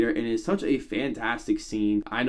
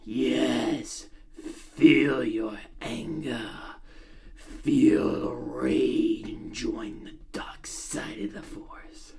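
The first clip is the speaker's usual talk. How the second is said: put-on slow, soft, sinister old voice sinking to a whisper; Emperor impression; 4 dB quieter, much slower than usual. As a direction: drawl it out like an evil emperor. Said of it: "I'm gonna try to do impressions".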